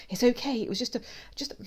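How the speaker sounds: high voice stuttering on "mouse"; nervous stammer. A narrator's reading. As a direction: start quick, then trip on the m. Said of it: "she stammers".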